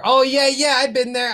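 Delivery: high, loud sudden recognition; light up with recognition; excited. Real, not acted.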